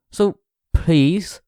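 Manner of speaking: usual speaking voice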